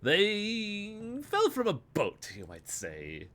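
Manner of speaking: pleased collector, faint Russian lilt; a touch of smug delight in a faint Russian accent; proud, lightly Russian. Acted. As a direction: savour the boast. Voices the man character.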